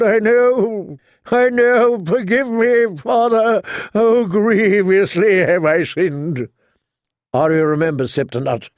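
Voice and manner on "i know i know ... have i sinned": high, drawn-out wail of confession. A narrator's reading. Captured Septon begs forgiveness for his sins, wailing. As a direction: wail higher, begging to be forgiven. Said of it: "the Septon wailed".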